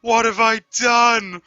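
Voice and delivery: high, slow panicked wail rising to a shout; mock panic. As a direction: wail it like a drunk who just ruined everything. Drunk friend panicking over spilled cheese.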